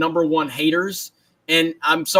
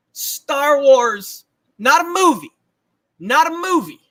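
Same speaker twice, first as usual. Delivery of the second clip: Allen Iverson rant; 6 semitones higher, slower than usual. Retold or imitated. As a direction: bark it like the famous press conference. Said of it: "the new Allen Iverson".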